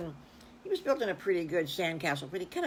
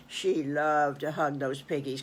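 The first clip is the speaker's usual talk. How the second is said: ordinary delivery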